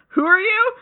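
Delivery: high-pitched mock yell; kids yelling. Playground kids questioning a passing Bart, mock yell. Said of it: "a bunch of kids yell".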